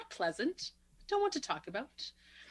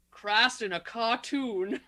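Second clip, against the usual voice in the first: slow, drawn-out imitation of the quip; mock movie quote; much slower than usual. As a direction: deliver it slowly, like a corny action hero. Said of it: "How about this one?".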